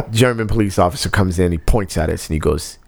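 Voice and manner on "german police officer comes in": usual speaking voice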